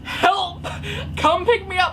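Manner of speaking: high, loud plea for rescue; frantic retold cry for help; mock panic. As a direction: beg mom for help, hammed-up.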